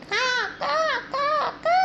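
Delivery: very high cawing crow; squawking crow call for kids. Shown as a demonstration. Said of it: "the crow sound is".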